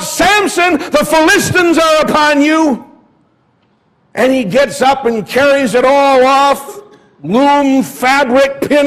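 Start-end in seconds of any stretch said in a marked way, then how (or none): 0.0-2.8 high, loud alarm call; crying out. Acted. Delilah warning Samson of attackers, crying out loud. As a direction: sound the false alarm to wake him. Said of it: "she cries out".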